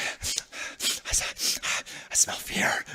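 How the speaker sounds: whispered menace; hushed taunting whisper. Real, not acted. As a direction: breathe it out in a creepy whisper.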